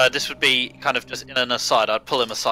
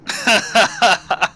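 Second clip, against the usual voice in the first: sudden high laugh; burst of laughter; 9 semitones higher, 5 dB louder than usual. Real, not acted. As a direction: laugh out loud. Laughs at belly rumble joke.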